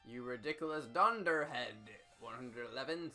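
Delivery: ordinary talk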